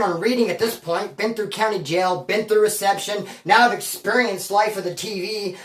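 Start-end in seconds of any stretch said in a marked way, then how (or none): none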